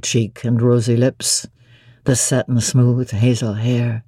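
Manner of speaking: deep, low, doting voice; Rochester, fond. A narrator's reading. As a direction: croon it low to his bride.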